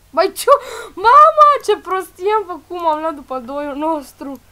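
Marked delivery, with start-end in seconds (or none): 0.1-2.8 upset cry. Real, not acted. Panics at a mistake with Cortana.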